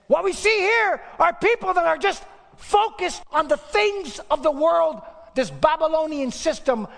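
high, loud, slowing preacher's cry; preaching at full force. Real, not acted. Preacher decrying worldly people with a high, loud cry. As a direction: thunder it out at the congregation.

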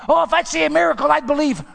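high, loud mock whine of a doubter; mock voice of an unbeliever. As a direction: parody the excuse, loudly. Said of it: "mocking the doubters' claim".

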